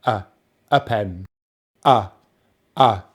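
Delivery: "a" as a short, low "uh"; schwa drill. Shown as a demonstration. Teacher drilling the indefinite article, schwa demo. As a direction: repeat "a" as a neutral weak vowel. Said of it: "the schwa sound".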